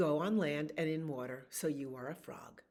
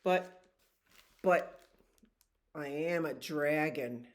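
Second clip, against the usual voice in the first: louder, insistent dragon; loud protest; 6 dB louder than usual. Acted. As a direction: push back hard, but but.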